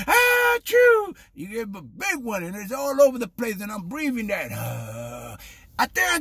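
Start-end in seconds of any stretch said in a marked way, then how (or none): 0.1-1.1 big loud high-pitched fake sneeze; mock sneeze. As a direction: act out an explosive "ah-choo". Imitates a sneeze beside him.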